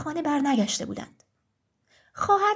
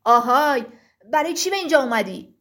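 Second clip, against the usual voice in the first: giant's shout; 2 semitones lower, 5 dB louder than usual. Acted. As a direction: call out loudly at an intruder. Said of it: "تخت سنگ داد زد".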